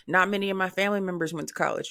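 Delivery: usual speaking voice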